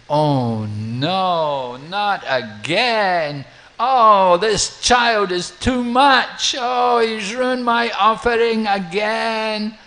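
slow, high, loud despairing wail; an exasperated priest wailing loud and high; exasperated lament. Acted. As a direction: moan it out like it happened again. Brahmana's offering ruined again.

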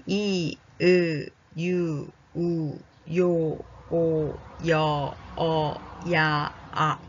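brisker, evenly paced vowel drill. Shown as a demonstration. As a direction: run through the vowels with little gap. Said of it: "もうちょっと早く読んでみましょうか".

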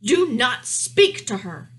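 loud, slow royal command; thundering. A narrator's reading. King warns the mouse off his daughter, thundering slowly. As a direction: boom each word as the king. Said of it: "thundered the king".

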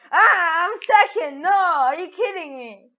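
high, loud wail easing slightly; dismayed outburst. Real, not acted. Player upset at placing second, dismayed outburst. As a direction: wail in disbelief at losing.

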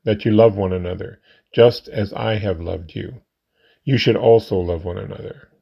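ordinary talk

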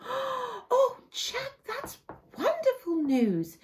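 high, slow, gushing mother's voice; delighted mother. Acted. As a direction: melt with happy relief. Mother answers Jack.

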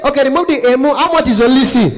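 high, loud bargaining voice; haggling caller. Acted. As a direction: haggle absurdly as an excitable client.

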